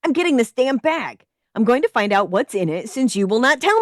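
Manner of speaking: loud, high yell. A narrator's reading. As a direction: shout it defiantly. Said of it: "I yelled".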